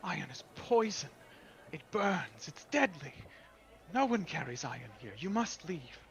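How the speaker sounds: high, loud alarmed fairy lady; alarmed water woman. Acted. Water woman ordering the stranger to leave, high and alarmed. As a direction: recoil from the iron and send him away. Pool woman insists iron deadly.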